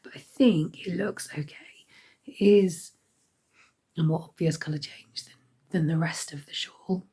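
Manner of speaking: hoarse whisper. Real, not acted. Sick vlogger with failing voice.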